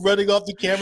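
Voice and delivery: ordinary delivery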